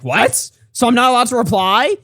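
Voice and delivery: high-pitched put-on outrage, rising to a question; mock indignant comeback. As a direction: protest like someone pretending not to be mad. Acts out the man's indignant reply.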